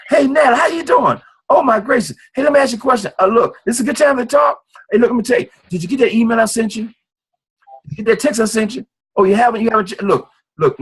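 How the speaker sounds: bright eager call to a friend; smile in your voice. Shown as a demonstration. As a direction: sell it with real excitement. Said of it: "put a smile in your voice".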